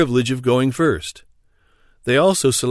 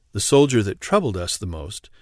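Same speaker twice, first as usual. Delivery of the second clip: plain reading voice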